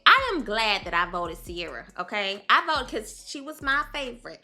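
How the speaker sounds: ordinary talk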